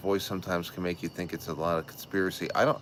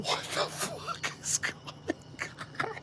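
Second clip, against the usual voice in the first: stunned disbelief; 8 dB quieter than usual. Retold or imitated. Retells his shocked reaction.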